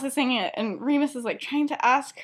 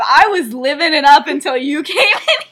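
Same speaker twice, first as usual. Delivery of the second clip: loud, higher, laughing through the quote; laughing mock complaint; 5 semitones higher, 11 dB louder than usual. Friend jokes about a ruined mood, laughing mock complaint. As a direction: tease loudly, cracking up.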